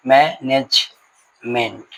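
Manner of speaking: Management very slow, split into मै, नेज, meant; syllable by syllable. Shown as a demonstration. Teacher showing students how to break up a word, syllable by syllable. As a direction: break management into three separate syllables with pauses. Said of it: "इस तरीके से हम लोग शब्द को तोड़ेंगे".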